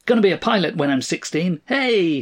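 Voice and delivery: put-on high, quick boyish boast; excited little boy. A narrator's reading. Son boasts of piloting, high and eager. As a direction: rattle it off high like an eager child. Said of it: "his son ran in".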